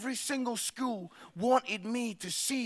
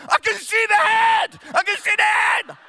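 loud, much higher shriek; melodramatic scream; 10 semitones higher, 9 dB louder than usual. Retold or imitated. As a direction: scream it like an overacting child. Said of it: "So she just goes".

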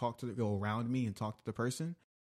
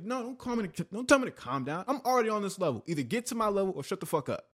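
higher and louder, heated; retold angry outburst; 10 semitones higher, 3 dB louder than usual. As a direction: replay the blow-up at the gym man. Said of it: "That was my word verbatim".

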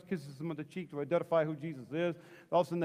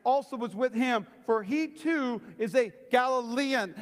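higher, slow, emphatic accusation; insistent; 6 semitones higher, slower than usual. Acted. Another bystander accuses Peter an hour later, slow emphatic insistence. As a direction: insist it, drawing out each phrase. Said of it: "still another insisted".

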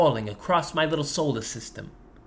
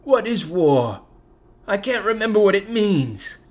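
put-on old man whimpering. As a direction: whimper like a lost grandpa. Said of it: "he whimpers".

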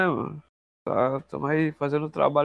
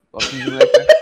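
laughing; 10 dB louder, much faster than usual. Real, not acted. Cracking up telling nickname story.

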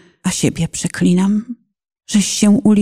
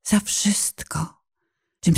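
slow whisper; 2 semitones lower, 7 dB quieter than usual. Acted. Hushed confession.

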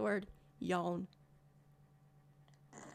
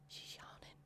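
whispering; 2 semitones higher, 22 dB quieter than usual. Real, not acted. Whispering about yawning partner.

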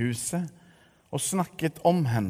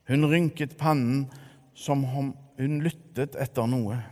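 ordinary delivery

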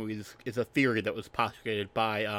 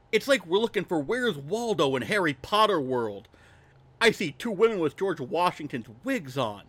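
higher-pitched, swinging put-on character voice; Roman; 5 semitones higher than usual. Co-host quoting a confused movie character, higher put-on voice. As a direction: play the confused character in a raised, lilting voice. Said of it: "I'm talking with Roman's voice like he's".

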